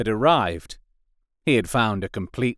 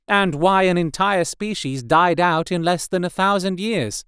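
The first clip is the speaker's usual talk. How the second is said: higher, earnest scholarly voice; formal explorer; 7 semitones higher than usual. Acted. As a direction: plead politely to her lord. Second in command makes her request.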